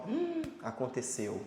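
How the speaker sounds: soft, slow, sombre hum then aconteceu; softly sombre after hearing of a death; grim resignation. Acted. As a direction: let it fall quietly, like bad news. Acted grim reaction to fatal crash.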